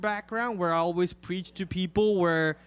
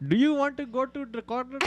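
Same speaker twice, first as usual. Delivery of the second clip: a lightly put-on cabbie, higher; taxi driver voice; 6 semitones higher than usual. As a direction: play the chatty taxi driver. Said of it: "taxi voice is impressive".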